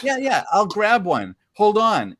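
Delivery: loud, high, drawn-out reply; calling down phone. Retold or imitated. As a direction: play the obliging reporter on a long-distance line. Acted reporter calling down the line.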